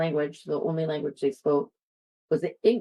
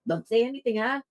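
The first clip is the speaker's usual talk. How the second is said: fake Tagalog accent; 5 semitones higher than usual. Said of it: "I'd just be like".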